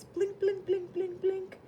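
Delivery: high, chiming blink blink; ping out each blink like a flashing light; blinking lights sound. Acted. Fire engine flashing its lights, high chiming blinks. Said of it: "I flash my lights".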